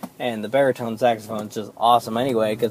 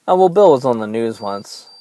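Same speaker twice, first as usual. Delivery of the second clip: ordinary delivery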